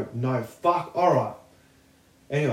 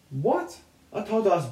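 ordinary talk, nothing marked

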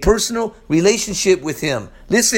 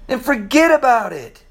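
Italian-style; 5 semitones higher than usual. Acted. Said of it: "Be like an Italian".

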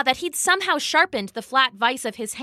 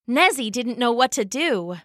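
usual speaking voice

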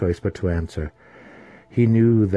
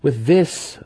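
smug assassin; 8 semitones higher, 5 dB louder than usual. Acted. Massage explains.

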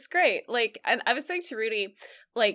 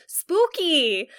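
quoted punchline; 6 semitones higher, 5 dB louder than usual. Retold or imitated. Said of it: "she goes".

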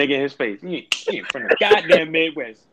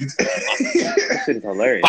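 slow, higher, through laughter; laughing; 10 semitones higher, much slower than usual. Real, not acted. Friend cracking up at a basketball theory, slow laughing repeat. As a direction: let it dissolve into laughs, slowly.

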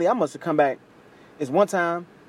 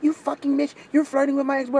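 high-pitched mock tirade; retold angry outburst, pitched way up; going off; 9 semitones higher than usual. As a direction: imitate a girl laying into her mom. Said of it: "go off on her mom".

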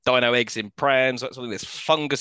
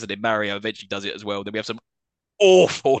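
normal talking voice

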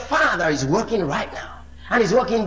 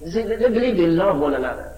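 ordinary talk, nothing marked

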